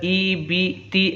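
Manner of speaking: ई बी ती with clear "ee" vowels; each syllable of ई बी ती ending in "ee"; zer sound. Shown as a demonstration. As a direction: demonstrate ई बी ती as "ee, bee, tee". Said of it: "ज़ेर की आवाज़ नीचे को जाती है".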